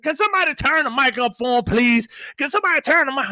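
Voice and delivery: high, hurried call for the sound crew; imitating the host. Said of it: "and the fucking host is".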